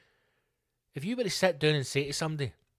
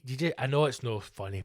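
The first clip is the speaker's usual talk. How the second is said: ordinary delivery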